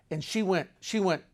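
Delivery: ordinary talk, nothing marked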